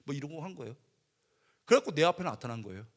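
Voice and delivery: ordinary delivery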